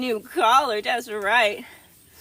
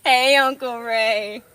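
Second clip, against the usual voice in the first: slow silly voice; 4 dB louder, slower than usual. Pretend. Goat voice greeting.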